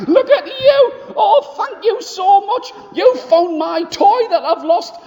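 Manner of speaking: put-on very high, laughing little-boy voice; little boy laughing at the bully's belt; giggling little boy. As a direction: squeal it through giggles of delight. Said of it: "He started to giggle".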